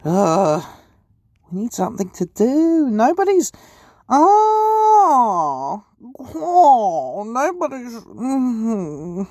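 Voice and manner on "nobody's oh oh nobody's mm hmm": mock sob. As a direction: fake crying, high and whiny. Mock crying that nobody asks.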